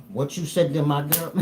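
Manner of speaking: high, challenging tough-guy line; confrontational challenge. Pretend. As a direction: square up to him. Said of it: "acting confrontation for his girl".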